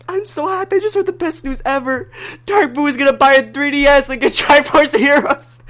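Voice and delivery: very high, gushing retold joy breaking into a laugh; retelling own overjoyed joke announcement in the chat; giddy squeal. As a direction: squeal the good news. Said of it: "And I'm like".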